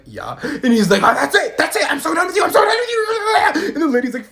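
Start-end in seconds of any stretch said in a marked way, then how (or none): 0.0-0.4 low, very soft answer; meek admission. Retold or imitated. Small answer to dad. 1.0-3.7 high, loud hammed-up tantrum; furious dad. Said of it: "I can see the fury".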